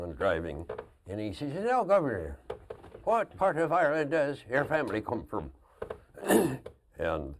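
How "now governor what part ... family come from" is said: loud, high Irish brogue; Irish bus driver. Retold or imitated. Driver asking governor about family roots, Irish accent. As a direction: call out as a hearty Irish driver imitation. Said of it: "John, the bus driver".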